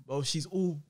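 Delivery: ordinary talk, nothing marked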